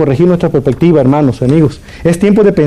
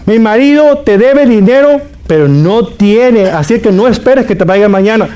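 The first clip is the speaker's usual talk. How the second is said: defiant wife; 6 semitones higher than usual. Retold or imitated. Wife tells neighbour off.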